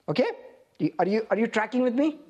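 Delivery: ordinary talk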